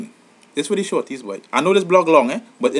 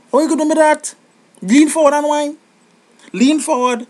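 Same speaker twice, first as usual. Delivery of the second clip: exasperated pleading; 10 semitones higher, 4 dB louder than usual. Pretend. Pleading with a dancer leaning back.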